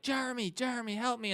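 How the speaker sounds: high-pitched mock plea for rescue; trapped child begging for help, hammed up; pleading cry for help. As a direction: call out in high, play-acted distress. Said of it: "transitioning to Evan in his own body".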